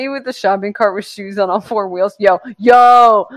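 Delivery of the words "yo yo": shouted yo. Acted. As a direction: shout it out like hyping a friend. Calling out about the meme picture.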